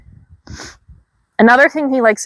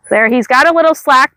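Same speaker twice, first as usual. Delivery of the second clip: normal talking voice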